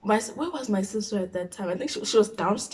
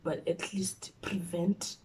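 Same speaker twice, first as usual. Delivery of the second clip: slow whispered plea; 3 semitones lower, 10 dB quieter, slower than usual. Retold or imitated. Begging God in a whisper before the beating. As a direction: breathe the plea out slowly.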